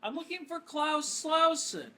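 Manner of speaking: sweet polite caller. Acted. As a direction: put on a polite innocent voice.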